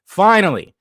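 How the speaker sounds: ordinary talk, nothing marked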